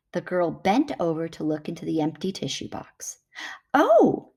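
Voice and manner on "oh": high, loud gasp of surprise; a startled little oh; surprised. Acted. Girl peering into the box, surprised. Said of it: "she said in surprise".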